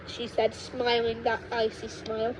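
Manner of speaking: usual speaking voice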